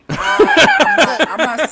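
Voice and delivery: high loud laughing protest. Real, not acted. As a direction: crack up mid denial. Laughing denial.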